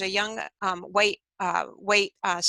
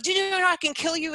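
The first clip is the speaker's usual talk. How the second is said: high-pitched, acted menace; angry threat; 8 semitones higher than usual. Angry man threatening the student he holds, heated. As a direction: deliver it as an imitated angry threat. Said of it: "growing angrier and angrier".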